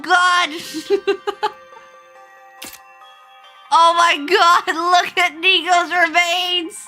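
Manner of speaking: ordinary talk, nothing marked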